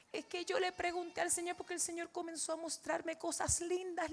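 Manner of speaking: high, anguished imitation of a crying father; imitated weeping plea. Father cries out to God asking why now, imitated tearful anguish. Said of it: "empezó a llorar, pero gemir y gemía".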